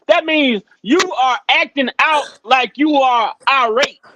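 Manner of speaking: normal talking voice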